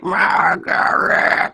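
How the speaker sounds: raspy demonic whisper, drawn out slowly; demonic scratchy voice. Retold or imitated. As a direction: breathe the name out like a demon from the dark. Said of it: "like the most demonic, scratchy voice".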